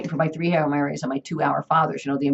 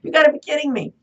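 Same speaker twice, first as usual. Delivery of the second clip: much higher, incredulous; high mock disbelief; 9 semitones higher, 3 dB louder than usual. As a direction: squeak out the disbelief.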